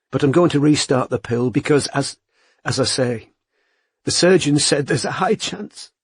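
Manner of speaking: rising pitch as the voice cracks; voice breaking. A narrator's reading. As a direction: let the voice break at the end. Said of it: "Her voice broke".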